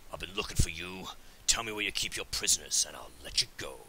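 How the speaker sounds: low, measured threat as an orc; lightly voiced orc menace; orc threatening robbers. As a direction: play the orc demanding answers, dropping low at the end. In-game threat to robbers, read aloud.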